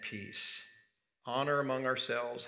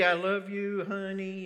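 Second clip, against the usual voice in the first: lightly acted endearment, pitched higher; easy endearment; 6 semitones higher than usual. Partner saying what is easy to say, lightly acted endearment. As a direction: toss off a sweet nothing, raised in pitch. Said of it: "It's easy to say".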